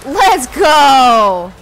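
loud, high, drawn-out rallying shout; hyped shout. Real, not acted. Streamer cheering on the game, hyped shout. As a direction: shout it out big and long.

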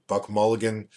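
usual speaking voice

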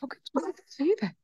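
voicing the dog, high and hurt; a lightly voiced dog, quietly wounded. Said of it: "he looks at you like".

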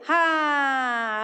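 slow, loud, stretched હા that leads the line; loud demo yes. Shown as a demonstration. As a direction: show the kids how loudly to shout હા. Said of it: "મોટેથી બોલવાનું". (મોટેથી ખેંચીને હા બોલી બતાવવું)

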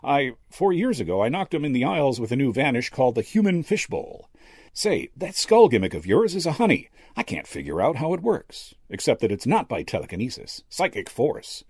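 a hint of a boastful stage magician; brash magician. A narrator's reading. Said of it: "the magician snorted".

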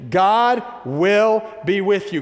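high, slow, ringing proclamation; positive proclamation. Real, not acted. Preacher leading the congregation to repeat it, proclaiming high and slow. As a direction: proclaim it slowly, stretching each word. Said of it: "a little bit more positive proclamation".

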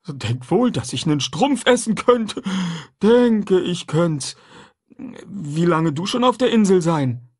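a simple, eager man talking food; runaway man chatting with the boy; Jim's voice. A narrator's reading.

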